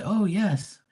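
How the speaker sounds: high, coy blushing yes; shy waitress answering a flirty customer. Retold or imitated. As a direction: act bashful and sweet. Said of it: "She goes".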